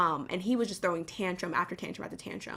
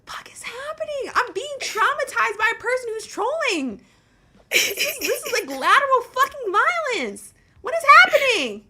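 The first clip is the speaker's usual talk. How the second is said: exasperated disbelief; 14 semitones higher, 8 dB louder than usual. Retold or imitated. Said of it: "I'm like".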